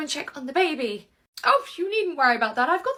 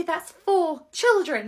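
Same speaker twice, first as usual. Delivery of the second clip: pointed; 6 dB louder, much slower than usual. Acted. Pointed correction in an argument.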